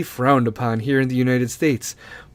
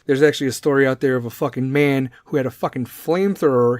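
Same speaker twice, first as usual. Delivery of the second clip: usual speaking voice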